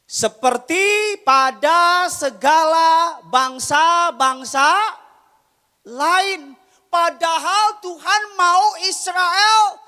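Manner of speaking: loud, slow, high preaching climax; full-force preaching. Acted. Preacher thundering to the congregation, loud and slow. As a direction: preach it out to the church, slowly and loudly.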